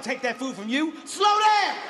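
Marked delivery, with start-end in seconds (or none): 1.0-1.8 loud, high scolding shout; exasperated yell. Acted. Comic scolding a fast eater, high exasperated yell.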